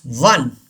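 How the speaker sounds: WON as WUN; like "one". Shown as a demonstration. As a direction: rhyme "won" with sun. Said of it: "like O N E". (won को "वन" बोलें)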